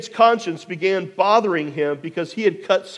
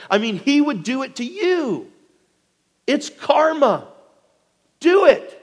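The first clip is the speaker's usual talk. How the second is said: urgent egging on; 7 semitones higher than usual. Acted. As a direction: goad him harder with every phrase. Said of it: "he urges David".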